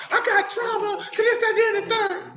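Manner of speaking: high mocking whine about trauma; hammed-up high voice of a self-pitying guy; comic complaining about whiners, high mock voice; mocking whiny victim.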